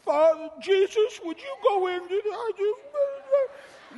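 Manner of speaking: slow, high, drawn-out mock whimper; mocking feeble prayer. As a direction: whine the prayer pitifully, dragging it out. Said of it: "Here's how Christians go".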